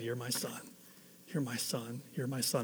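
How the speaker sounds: gentle voice of God. Retold or imitated. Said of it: "And he said".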